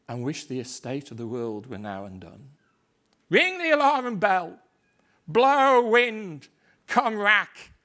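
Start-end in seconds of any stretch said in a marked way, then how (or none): 3.3-4.6 high, loud commanding cry; full shout. Acted. Macbeth ordering the alarm, full shout. 5.3-7.7 high, loud defiance; defiant shout. Acted. Macbeth defying fate, loud.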